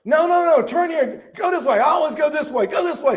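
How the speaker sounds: put-on very high, insistent old lady; bossy Miss Daisy. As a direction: order the driver around in a high voice. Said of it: "what does she say".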